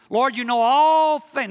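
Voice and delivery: high, loud, drawn-out protest; mock-frustrated shout with a long ALL; exasperated outcry. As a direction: cry it out, stretching ALL. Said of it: "Now, now you shift".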